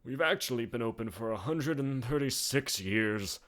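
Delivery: put-on proud old bartender boasting; old barkeep. A narrator's reading. Gainsborough voice.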